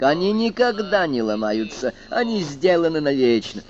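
insist, deeply offended; indignant. Acted. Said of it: "вознегодовал техник".

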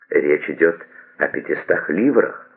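ordinary delivery, nothing marked

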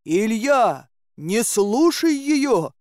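high, drawn-out warning call; crying out. A narrator's reading. Dobrynya warns Ilya off the maiden, crying out. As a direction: call out urgently, stretching each word. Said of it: "крикнул он".

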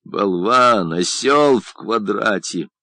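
plain reading voice

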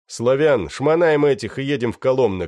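loud shouted order. Acted. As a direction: call out to partner. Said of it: "крикнул я". (громкий крик)

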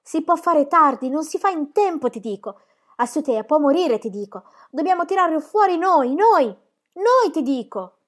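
high, loud desperate yell; shouting. A narrator's reading. Shepherd boy yells at old man to deliver the baby, desperate shout. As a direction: shout it, pleading to act now. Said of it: "Unai urlò".

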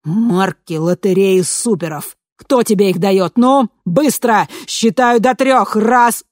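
loud slow counting threat; threatening shout. A narrator's reading.

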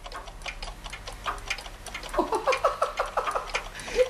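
high, breathy kobold giggle; long soft giggle climbing high; giggling. Acted. Kobold delighted by ticking clocks, giggling.

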